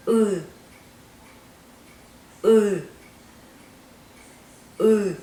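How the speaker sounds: U said three times as the Russian Ы, tongue pulled back from E; Russian yery sound. Shown as a demonstration. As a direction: hold the vowel between hill and boom. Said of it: "Repeat after me the sound U".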